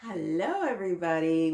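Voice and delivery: ordinary delivery, nothing marked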